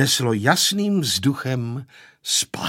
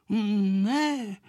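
put-on drawn-out, higher, dignified boy's reply; 5 semitones higher, 4 dB quieter than usual. A narrator's reading. Pepíček answering the scolding grandfather, dignified. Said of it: "odpověděl Pepíček důstojně".

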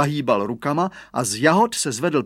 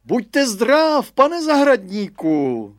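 high, loud hail; calling out; 10 semitones higher, 6 dB louder, slower than usual. A narrator's reading. Headmaster greets the gardener over the fence, calling out. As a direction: call a drawn-out greeting across the fence.

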